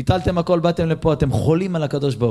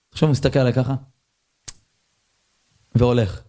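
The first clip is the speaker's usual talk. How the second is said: ordinary delivery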